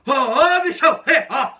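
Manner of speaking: loud call that breaks into a knowing laugh; greeting with a laugh. Shown as a demonstration. Worker showing the warning hello, loud with a sly laugh. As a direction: shout the hello, then snigger at the end. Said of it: "Quer ver, ó?".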